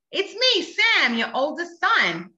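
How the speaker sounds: put-on high, insistent child voice; Sam. Dream son answers his doubting mom, high child voice. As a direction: imitate a son identifying himself to mom. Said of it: "I hear a voice on the other side".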